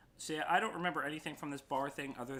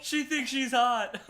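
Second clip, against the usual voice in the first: high, loud laughing line; 13 semitones higher, 11 dB louder, slower than usual. Real, not acted. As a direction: laugh through the words.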